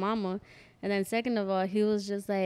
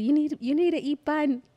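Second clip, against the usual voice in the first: higher-pitched mock mom voice, insistent; raised, pleading imitation of a mother; urging mom; 6 semitones higher, 4 dB louder than usual. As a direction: lightly voice a mom urging food on someone. Voicing Freddie's mom urging her.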